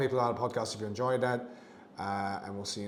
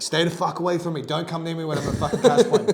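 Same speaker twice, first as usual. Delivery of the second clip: louder, higher furious shout; angry yell; 5 semitones higher, 9 dB louder than usual. Retold or imitated. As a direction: bark him away from the cash point. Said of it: "he's like".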